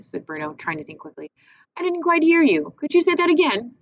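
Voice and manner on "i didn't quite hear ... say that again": much higher, louder, faux-innocent; boy pretending he didn't hear; fake innocence. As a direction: play dumb, brightly. Bruno feigning innocence.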